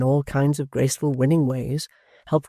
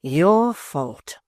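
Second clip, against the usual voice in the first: reproachful; 3 semitones higher, 4 dB louder than usual. A narrator's reading. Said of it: "cried Missus V reproachfully".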